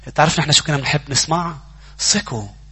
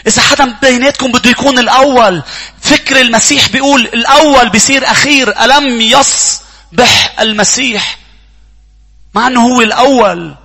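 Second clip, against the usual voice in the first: loud, high preaching at full force; 9 semitones higher, 9 dB louder than usual. Acted. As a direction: preach it out to the whole church.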